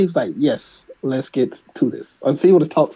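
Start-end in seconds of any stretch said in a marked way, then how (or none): 0.4-2.2 slow, softer, lower, mock-proper answer; mock proper speaker. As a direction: play it very proper, measured and unhurried. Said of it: "said something very proper".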